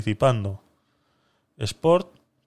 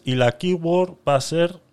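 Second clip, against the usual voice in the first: normal talking voice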